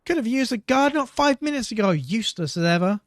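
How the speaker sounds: high, loud scornful pirate voice; glowering captain. Acted. Wounded pirate captain scolding the guard, high scornful voice. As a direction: sneer it at the useless guard. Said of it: "She glowered at him".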